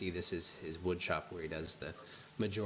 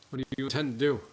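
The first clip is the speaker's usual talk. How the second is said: raised, wary carpenter voice; husband voice; 7 semitones higher, 9 dB louder, faster than usual. Acted. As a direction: ask it as a suspicious shopkeeper.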